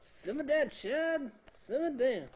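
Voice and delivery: high, loud hammed-up clerk scolding; mock skit clerk. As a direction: ham up the skit line, pitched high. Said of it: "she kept saying".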